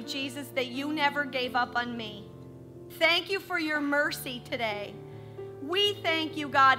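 ordinary talk

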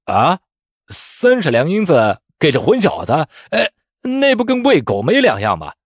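startled, scornful protest swooping high; taken aback. A narrator's reading. Examiner astonished at money given to a wretch, taken aback. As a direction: balk at the waste in disbelief. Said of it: "那考官一愣".

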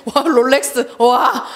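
mock-awed wow; imitated admiration. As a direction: act out being impressed by a luxury watch. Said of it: "더 대단하게 생각하는데". (감탄하는 척 와 하고)

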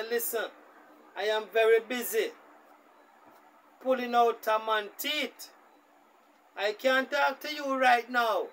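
a hint of a busy dad brushing her off; busy father. Dentist father to his daughter on the phone, a hint of impatient dad.

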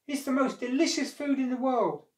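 high, loud and enthusiastic; monkey's keen call. A narrator's reading. Monkey tempting the crocodile with fruit, calling keenly. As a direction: sing the fruit's praises down from the branch.

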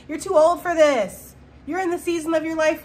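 mock scolding. Mocking naysayer.

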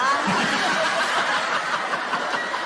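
helpless laugh after the punchline; laughing at own joke. Real, not acted. Friend telling jokes, cracks up at his punchline.

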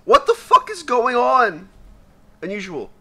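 usual speaking voice

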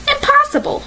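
high-pitched cry of disbelief; exclaiming. A narrator's reading. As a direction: exclaim it high, without extra volume. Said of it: "George exclaimed".

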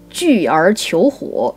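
ordinary delivery

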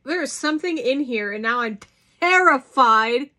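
fast, loud mock alarm; playfully spooked by the filling; mock terrified. Real, not acted. Finds something inside the sweet.

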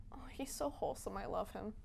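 unvoiced adoring whisper; whispered gush. Real, not acted. Narrator gushing about the author, whispered. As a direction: whisper it like a secret crush.

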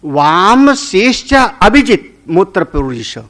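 measured scriptural declaiming; verse recitation. Shown as a demonstration. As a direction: intone the verse line, drawing out the first words. Recites the next verse line.

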